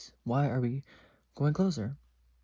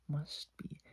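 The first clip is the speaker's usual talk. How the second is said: breathy whisper under the breath; whispered aside; 3 semitones higher, 9 dB quieter than usual. Retold or imitated. Whispering to herself that the family must be kidding. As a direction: mutter it to yourself in a whisper.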